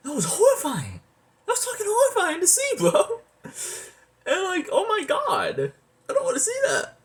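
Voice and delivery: real horrified squeal about a gory opening; horrified outburst. Real, not acted.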